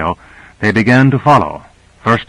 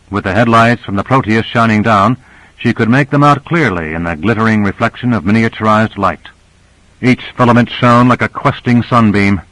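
plain reading voice

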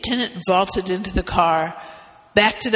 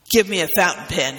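snapped; faster than usual. Acted. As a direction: fire the demand off fast like a surgeon. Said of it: "he snapped".

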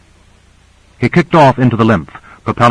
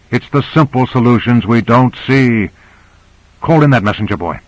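ordinary delivery

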